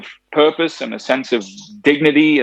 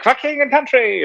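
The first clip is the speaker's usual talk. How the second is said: much higher, bird-like voice for the line; Zazu impression; 13 semitones higher than usual. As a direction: squawk it up high like the cartoon hornbill. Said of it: "Zazu's like".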